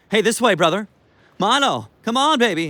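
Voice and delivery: high, loud coaxing call; dog training call. Shown as a demonstration. Owner practising voice on Milo, high coaxing call. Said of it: "I practice my voice with Milo".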